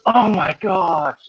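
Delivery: slow, drawn-out mock alarm; mock gasp of dismay. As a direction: stretch out the dismay like a startled student. Student exclaims during test.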